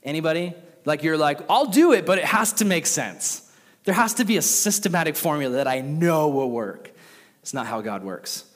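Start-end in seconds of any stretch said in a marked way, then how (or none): 1.5-6.9 mock insistent skeptic, higher and louder; imitating a picky logical thinker. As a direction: play the fussy logical type demanding sense. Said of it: "the logical thinker type".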